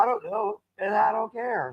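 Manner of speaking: high, airy brush-off; mock indifference. Answering fashion people's warning, airily dismissive. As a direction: wave it away in a high put-on voice.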